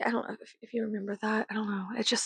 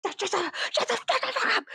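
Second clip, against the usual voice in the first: high squeaky mock voice, then snapping back; mocking imagined critic; 9 semitones higher than usual. Answering imagined viewers, a high mocking voice. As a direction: squeal the nagging question high and fast. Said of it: "And if you're".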